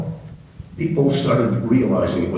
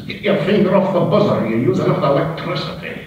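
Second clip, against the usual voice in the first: higher, lightly Scottish little man; Scottish accent; 9 semitones higher than usual. Acted. Scotsman scolding about the buzzer, Scottish accent. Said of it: "was a little Scotsman".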